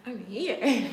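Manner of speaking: loud taunt. Real, not acted. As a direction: announce yourself defiantly. Loud taunt after laugh.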